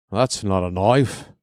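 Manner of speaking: put-on "That's not a knife" in a crap Crocodile Dundee Australian accent; clumsy Australian accent, "knife" lifted like a question; bad Australian accent. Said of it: "My Australian accent is crap".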